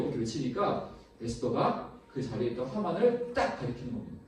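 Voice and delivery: normal talking voice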